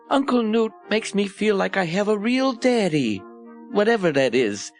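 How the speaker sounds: put-on high-pitched little boy voice; high boyish voice, dropping on the afterthought; young orphan boy. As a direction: speak up like a small child. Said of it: "said one young boy".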